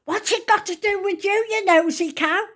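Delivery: high-pitched scolding outburst; shrieky mock telling-off; retold indignant shout. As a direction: imitate an affronted neighbour telling someone off. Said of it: "She shouts to me".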